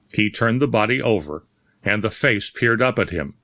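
ordinary delivery

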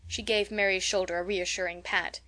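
ordinary delivery

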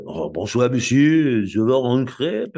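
playful imitation of a French accent. Ordering a crêpe in a put-on French accent. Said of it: "that's what I hear there".

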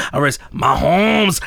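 drawn out slow mock call of Mahomes; mock cheer. Telling cohosts how he shouted the player's name, slow hyped call. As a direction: stretch the name like a hype announcer. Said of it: "I had to keep saying it".

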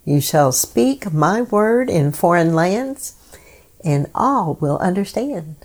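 normal talking voice

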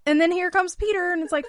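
ordinary talk, nothing marked

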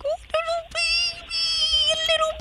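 put-on baby talk. Prank caller gushing over the bear in baby talk. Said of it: "I started baby talking the bear".

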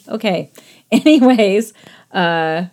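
ordinary delivery

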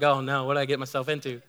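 quick, hushed aside to himself; mock-worried inner voice, hushed and rushed; inner dread. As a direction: mutter it fast like a private panic. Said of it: "I was like".

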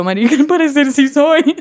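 retelling it through giggles, pitch climbing; laughing confession. Retold or imitated. Telling her friend what she admitted, high and giggly. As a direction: break up laughing on the punchline.

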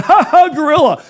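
high, loud teasing jeer; a zoo visitor teasing the gorilla from behind the bars; mocking taunt. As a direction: jeer at the caged animal like a cocky kid.

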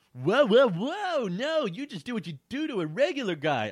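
high-pitched, hurried protest; acted high, quick objection. A narrator's reading. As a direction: blurt it as a hasty correction. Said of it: "I said quickly".

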